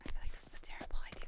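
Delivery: nervous whisper. Real, not acted. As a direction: whisper it worried. Worried whisper aside.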